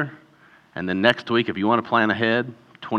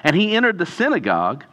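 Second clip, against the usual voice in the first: raised, animated Bible reading; scripture reading; 5 semitones higher, 3 dB louder than usual. Acted. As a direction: read the passage out boldly. Reads scripture aloud.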